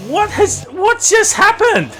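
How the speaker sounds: high, slow disbelieving question; bewildered. Real, not acted. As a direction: ask in total confusion. Confusion after crash.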